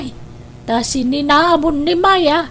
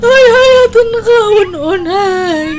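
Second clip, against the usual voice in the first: higher, slower sobbing plea, louder too; a mother crying out her love; 7 semitones higher, 6 dB louder than usual. Acted. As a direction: beg through tears, drawn out. Mother pleading with estranged son.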